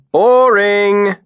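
loud, high cut-off; bored professor blurting over a student; cutting interjection. A narrator's reading. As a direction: cut him off with one loud word. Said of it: "Elodin interjected, cutting him off".

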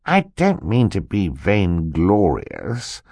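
slow, drawn-out uncle voice; boastful uncle. Acted. Uncle begins bragging on the lawn, self-important. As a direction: boast with slow false modesty.

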